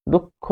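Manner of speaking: "দুঃখ" said duk-kho with a held k; doubled consonant. Shown as a demonstration. Teacher shows mid-word visarga doubling, "দুঃখ" as duk-kho. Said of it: "দ্বিত করবে".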